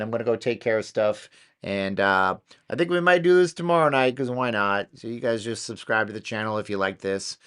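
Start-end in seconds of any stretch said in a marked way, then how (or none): none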